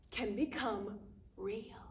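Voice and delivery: slow, whispery and soft; hushed wonder. Acted. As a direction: let it sink almost to a whisper, slowly. Magician's hushed stage line, then restated.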